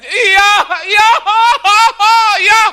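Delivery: high, loud demanding cry; calling out for the thief; excited shout. Retold or imitated. Robbed lady demands the thief. (teriakan tinggi dan keras)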